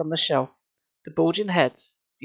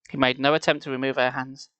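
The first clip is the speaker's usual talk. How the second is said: plain reading voice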